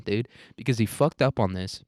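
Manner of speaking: ordinary talk, nothing marked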